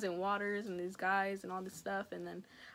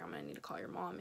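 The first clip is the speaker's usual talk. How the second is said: much lower, stern stepdad impression; 8 dB quieter, faster than usual. Teen quotes stepdad calling mom, lower and stern. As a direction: drop low like a serious dad.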